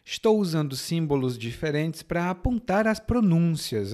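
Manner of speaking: ordinary talk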